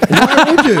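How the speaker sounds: high, loud mock disbelief; playful high-pitched challenge; incredulous teasing. Real, not acted. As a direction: tease him with a big high question. Friends teasing about heroin plans.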